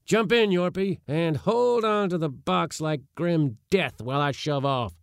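high, urgent scolding commands; agitated orders. A narrator's reading. Uncle shoving off, fearful for his box, agitated orders. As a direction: fuss and scold while giving orders.

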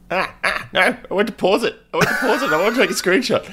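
high, flustered, laughing through it. Real, not acted. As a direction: fumble and laugh at yourself. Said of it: "What the hell was that?".

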